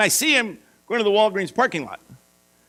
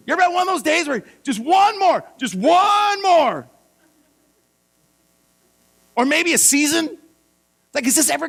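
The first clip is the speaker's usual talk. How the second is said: shouted climax; 6 semitones higher than usual. Real, not acted. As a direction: shout it out as the breaking point. Preaching climax.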